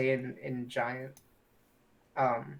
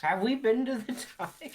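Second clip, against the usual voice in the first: surprised; 9 semitones higher, 4 dB louder than usual. Real, not acted. Surprised question to the table.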